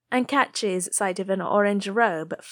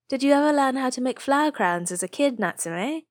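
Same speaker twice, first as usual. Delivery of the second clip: light and airy; 7 semitones higher than usual. A narrator's reading. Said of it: "Taki asks lightly".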